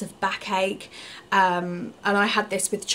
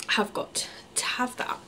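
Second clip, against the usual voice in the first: tearful whisper; 2 semitones higher, 7 dB quieter than usual. Real, not acted.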